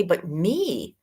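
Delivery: normal talking voice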